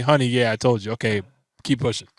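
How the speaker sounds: hushed meek husband; humble aside. Retold or imitated. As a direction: play it small and meek, like taking the compliment quietly. Voicing humble reply to wife.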